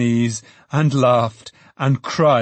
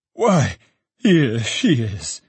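glad cry; slower than usual. A narrator's reading. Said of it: "cried Trotty".

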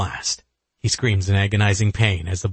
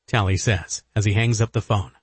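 plain reading voice

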